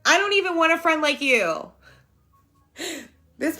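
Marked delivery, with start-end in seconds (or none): 0.0-1.7 high, childish mock tantrum; whiny little baby. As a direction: sulk like a baby disowning a friend. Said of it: "I was a little whole baby".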